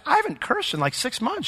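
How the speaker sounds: high-pitched, hushed amazement; amazed realization. Retold or imitated. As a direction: retell it as an astonished discovery. Retold amazed realization told to classmate.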